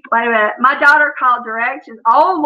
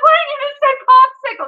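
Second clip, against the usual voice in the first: high, loud mock exasperation; exasperated; 13 semitones higher, 5 dB louder than usual. Daughter to her mother over baby word, exasperated. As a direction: demand the obvious in a raised pitch.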